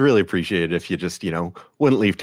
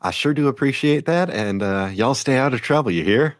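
higher, folksy officer sign-off; folksy officer Kara; 6 semitones higher than usual. Acted. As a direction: send them off with a courteous folksy warning. Game master plays the officer Kara.

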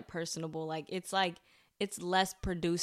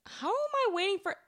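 mock indignation; 13 semitones higher than usual. Real, not acted. Protesting friend's tease.

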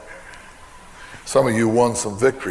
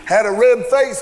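ordinary talk

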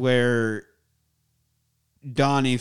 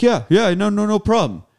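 higher, louder overeager agreement; hasty mock reassurance; 7 semitones higher, 5 dB louder than usual. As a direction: imitate someone insisting it's fine. Retells father's hasty reassurance.

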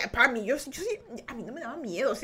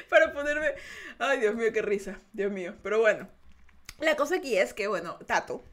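ordinary delivery

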